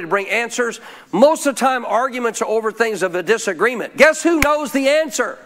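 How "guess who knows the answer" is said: preaching shout. Real, not acted. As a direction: build to a fervent shout. Sermon climax, rising fervour.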